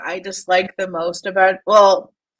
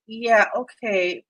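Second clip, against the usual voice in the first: ordinary talk, nothing marked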